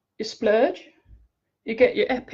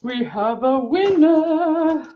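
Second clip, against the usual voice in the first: game show announcer; 5 semitones higher, 3 dB louder, slower than usual. Acted. Announcing the prize draw.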